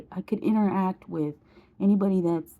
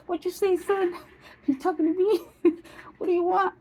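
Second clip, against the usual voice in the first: much higher, put-on poltergeist voice; 11 semitones higher, faster than usual. Showing viewers how stiff turning was, high poltergeist voice. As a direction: play a high-pitched poltergeist with laughs breaking in. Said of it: "Now I'm like the poltergeist".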